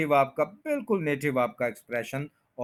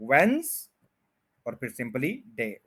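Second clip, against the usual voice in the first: syllable by syllable; slower than usual. Shown as a demonstration. Teacher breaking down Wednesday, syllable by syllable. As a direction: separate veins from डे, z for s. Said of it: "एस को जेड बनाया जाएगा".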